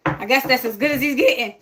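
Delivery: usual speaking voice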